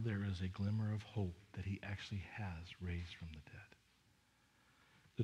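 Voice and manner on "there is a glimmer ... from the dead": whisper. Real, not acted. Pastor hushing on hopeful point.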